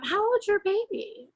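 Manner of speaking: high-pitched little old lady, lightly imitated; cute little old lady. Little old lady approaching a mom at the grocery store, imitated cute high voice. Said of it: "the cutest little old lady".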